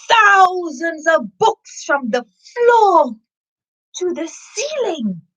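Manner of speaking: slow and high with wonder; awed. A narrator's reading. Reader marvelling at books floor to ceiling. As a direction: stretch it out in amazement. Awe at the huge library.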